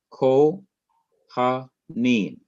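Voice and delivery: "Ko, ha, neem" sounded out in separate syllables; teacher sounding out "Ko, ha, neem" piece by piece. Shown as a demonstration. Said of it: "a three syllable word".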